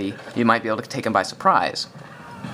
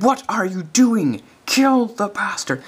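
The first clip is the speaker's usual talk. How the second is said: higher, slow mock American yell; imitated American accent; 9 semitones higher, slower than usual. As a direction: quote him high and drawn out, American accent. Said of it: "in an American accent".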